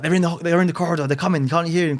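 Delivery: high, fast, panicked whisper-free rush; paranoid panic. Retold or imitated. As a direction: rattle it off like he's sure they're coming. Paranoid friend hears intruders.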